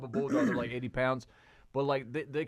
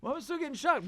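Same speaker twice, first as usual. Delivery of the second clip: high-pitched talking dog; squeaky puzzled pup, retold; confused dog's voice; 13 semitones higher, faster than usual. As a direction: speak as a baffled little dog.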